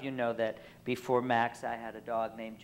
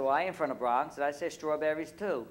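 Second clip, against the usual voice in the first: put-on raised, thick Brooklyn accent; 4 semitones higher, 4 dB louder than usual. Student piping up to the professor, thick Brooklyn accent. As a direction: protest in broad Brooklyn talk. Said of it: "obviously that's how you speak".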